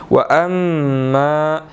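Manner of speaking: slow "वअम्मा" with a held nasal m; "वअम्मा" nasal, drawn out; ghunna on mim. Shown as a demonstration. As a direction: hum the double m of "वअम्मा". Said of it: "यहाँ पर हमने गुन्ना करना है".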